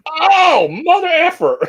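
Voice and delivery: high, loud mock dismay; a squeaky, shocked curse; exasperated outburst. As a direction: yelp it out like you just heard bad news. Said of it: "And I'm like".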